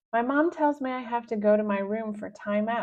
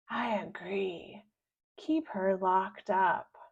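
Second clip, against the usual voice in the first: grumbling, mouth full; 4 dB quieter, slower than usual. Acted. Visitor at the kitchen table, grumbling with mouth full. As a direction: chew the words, grumpy. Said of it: "grumbles Missus Gobblecracker with her mouth full".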